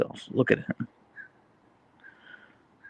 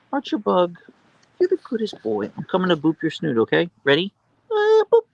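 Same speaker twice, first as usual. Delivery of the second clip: high, cooing pet voice, boop squeaked up top; 9 semitones higher than usual. Real, not acted. About to boop her husky's snoot, high baby talk. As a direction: coo at him, then squeal the boop.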